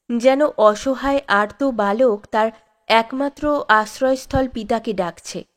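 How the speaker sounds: usual speaking voice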